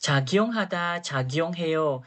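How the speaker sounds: "cakyong hada" drawn out very slowly and clearly; slow it right down so the student can copy; slow model reading. Shown as a demonstration.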